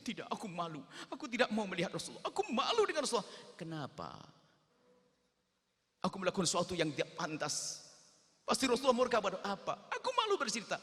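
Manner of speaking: rising high, loud wail of shame; ashamed sobbing boy. Acted. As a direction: beg not to be taken back.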